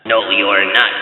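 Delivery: low, slow troll growl; slow and low like a troll under a bridge; deep troll voice. A narrator's reading. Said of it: "said the terrible troll".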